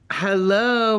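usual speaking voice

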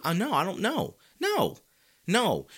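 high incredulous no. Real, not acted. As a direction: refuse in disbelief. Voicing refusal.

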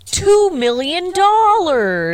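gushing excited self. Retold or imitated. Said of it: "I'm like".

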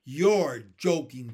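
loud, slow disbelief; emphatic boyish protest; incredulous. A narrator's reading. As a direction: stretch it out, refusing to believe. Boy disbelieves the centipede.

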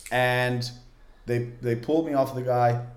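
ordinary talk, nothing marked

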